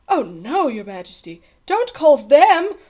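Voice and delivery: alarmed, pleading cry; shivering fear. A narrator's reading. Page boy begging the king not to call them, fearful. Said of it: "Bartholomew shivered".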